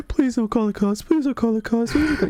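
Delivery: high, rising panicked pleading; desperate begging. Retold or imitated. Predator begging, retold.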